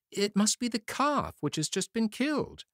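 high, far-off anxious woman; nervous wife. A narrator's reading. Ogre's wife lying to her husband, high and nervous. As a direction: cover quickly for the hidden boys.

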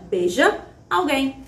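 ordinary talk, nothing marked

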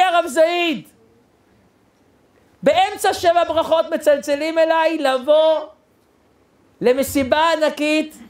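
high, slow, stretched-out proclamation; imitating an overexcited groom; groom's imitated shouting. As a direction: announce unbelievable news, pitched high throughout. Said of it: "איזה שאגה הוא צעק".

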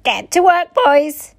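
high, loud command; ordering. A narrator's reading. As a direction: order the gang about sharply.

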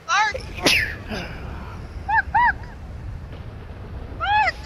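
spoken barks, high pitched; dog barking. Pretend. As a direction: bark like a playful dog. Playing a dog in game.

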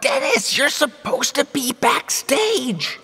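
high exasperated reprimand; exasperated scolding. Acted. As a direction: scold him for barging in. Scolding the intruder.

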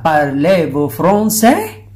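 slow, rising question; Parlez-vous français with a slow rise; slow French question. Shown as a demonstration. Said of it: "tatanungin ka".

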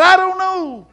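high, loud comic exasperation; yelled reply. Retold or imitated. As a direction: holler it like a frantic kid. Boy yells back, comic.

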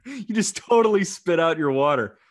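loud, high, delighted teasing; giddy laughing outburst; laughing excitement. Real, not acted. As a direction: crack up at the co-host spilling water. Cohost spits out water laughing.

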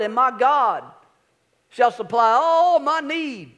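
loud, slow, high gospel-preacher intoning; a hint of an old-time gospel preacher drawing the verse out; Southern gospel voice. Shown as a demonstration. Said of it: "in the old Southern gospel voice".

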